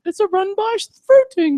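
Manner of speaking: very high mock-matron voice; Mrs. Doubtfire. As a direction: imitate a film nanny up in falsetto range. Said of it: "Robin Williams from Missus Doubtfire".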